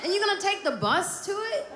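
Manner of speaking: sky-high squeal of mock indignation; indignant high voice. Retold outrage, high and loud.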